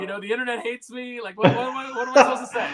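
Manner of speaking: high, exasperated mock plea with laughter; defensive. Imitating an actress excusing the mess, defensive.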